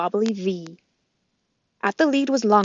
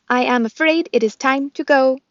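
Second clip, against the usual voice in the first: robot voice; 5 semitones higher, 8 dB louder than usual. Acted. Roz the robot speaks.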